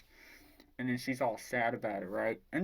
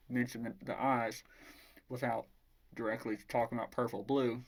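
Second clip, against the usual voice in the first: plain reading voice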